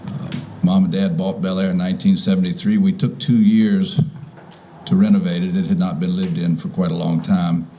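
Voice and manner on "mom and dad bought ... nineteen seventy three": ordinary talk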